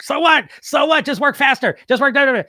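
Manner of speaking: high, loud mock nagging; pushy, high-pitched voice in the head; nagging inner voice. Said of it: "part of my brain's like".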